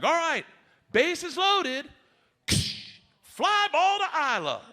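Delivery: high coach announcing the play; coach calling plays. Retold or imitated. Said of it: "Coach is like".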